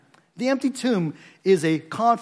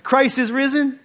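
much higher and louder, rising call: Christ is risen?; rousing call; 9 semitones higher, 5 dB louder than usual. Real, not acted. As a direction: lift it high, inviting the answer. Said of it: "let's do that one more time".

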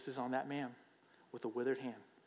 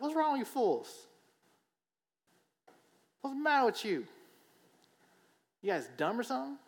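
much higher, mocking scold; mock insult; 10 semitones higher, 4 dB louder than usual. Jesus did not say this to Pharisees, high mock scold. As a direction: sneer at them like fools. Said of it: "be like".

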